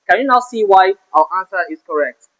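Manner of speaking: ordinary delivery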